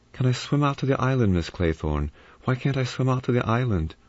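lightly voiced whining child; whiny child. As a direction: beg like a spoiled brat. Remembered whiny child pleading.

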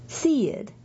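"Seed" stretched, stressed on its first part, falling away; first-part emphasis. Shown as a demonstration. Reader shows listeners how callers say the name, front-stressed "Seed". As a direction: lean on the front of "Seed", then let it drop. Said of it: "emphasis on the first part of that syllable".

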